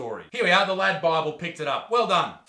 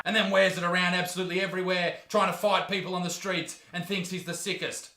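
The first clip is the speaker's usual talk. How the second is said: ordinary delivery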